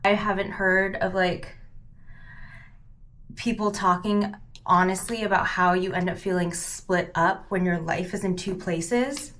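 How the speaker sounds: ordinary talk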